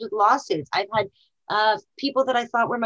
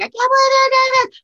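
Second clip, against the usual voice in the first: high, loud, wailing sob-voice "I can't believe it"; imitating herself breaking down in tears; mock crying wail; 10 dB louder than usual. Herself when let down by a partner, wailing in tears. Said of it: "start crying and be like".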